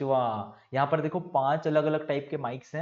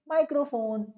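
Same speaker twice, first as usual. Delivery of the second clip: much higher, eager answer word; student's answer; 11 semitones higher than usual. Pretend. Answering the exam question, a keen student's high chirp. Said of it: "आप बोलोगे".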